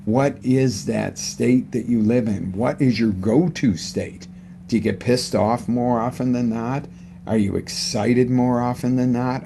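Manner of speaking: ordinary talk, nothing marked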